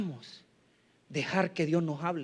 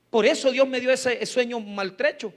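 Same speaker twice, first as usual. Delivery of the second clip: normal talking voice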